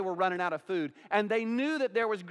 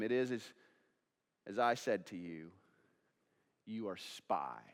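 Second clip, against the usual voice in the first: harsh confrontation; 12 semitones lower, 7 dB quieter than usual. Acted. Joseph confronts his brothers with their guilt, quietly harsh. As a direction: confront them sternly, very low and quiet, slowing on the last words. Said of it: "He's harshly confronting them".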